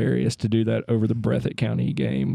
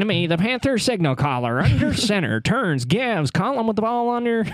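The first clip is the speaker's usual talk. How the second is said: put-on higher old-time play-by-play voice; 1940s radio announcer; 7 semitones higher than usual. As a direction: call the play like vintage radio. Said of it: "talk like that during play-by-play".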